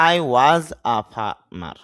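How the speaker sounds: I was a farmer spoken slowly, a as a light uh; slow clear teacher demo of I was a farmer; slow model sentence. Shown as a demonstration. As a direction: dictation pace for a learner. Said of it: "এ না বলে আমরা বলবো আ".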